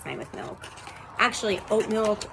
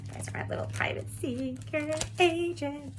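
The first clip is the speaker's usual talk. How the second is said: high, airy stage whisper; playful secret-agent whisper; 8 semitones higher than usual. Pretend. Tarot reader nicknaming her Virgo viewers, playful whisper.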